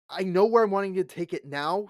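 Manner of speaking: ordinary talk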